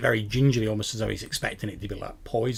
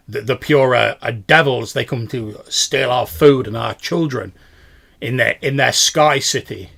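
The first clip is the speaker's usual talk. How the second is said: a touch of a gruff strongman, louder; loud, heavy villager warning of devils; lightly voiced gruff man; 9 dB louder than usual. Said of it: "in a gruff voice he says".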